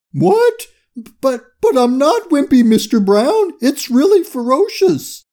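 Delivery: very high, flustered protest; panicked protest. A narrator's reading. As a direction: squeal a stammering defence to the boss. Panicked crook defends himself by phone.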